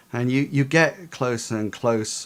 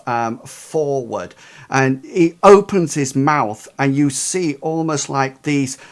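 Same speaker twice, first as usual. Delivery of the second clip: ordinary talk, nothing marked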